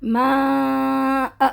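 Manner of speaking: بنا آ with a long drawn آ and no nun; pausal recitation. Shown as a demonstration. Teacher showing the pause on بنا آ. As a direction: stop on بنا and stretch آ for two counts. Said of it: "دیکھیں فرق پڑا".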